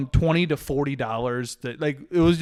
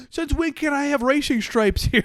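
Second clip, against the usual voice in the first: mock angry yell; 11 semitones higher than usual. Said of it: "yelling at them".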